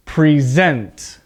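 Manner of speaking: "Present" stressed on the second syllable, pri-ZENT; verb stress preSENT. Shown as a demonstration. Teacher contrasts verb pronunciation for students, stress second syllable. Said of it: "listen to the difference in pronunciation as a verb".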